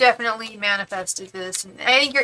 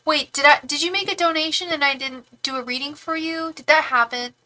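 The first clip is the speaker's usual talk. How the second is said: ordinary talk, nothing marked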